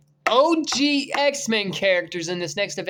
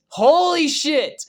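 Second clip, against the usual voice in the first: excited exclamation; 10 semitones higher, 8 dB louder, much slower than usual. Real, not acted. Escalated repeat.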